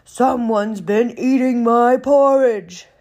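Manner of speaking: loud, booming bear voice; big indignant bear roaring out each word; great huge Papa Bear. Acted. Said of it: "in his great huge voice".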